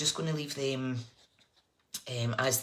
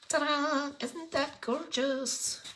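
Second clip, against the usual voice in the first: much higher, delighted ta-da; excited reveal; 11 semitones higher, much slower than usual. Real, not acted. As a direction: unveil it with slow, proud delight. Reveals the finished card.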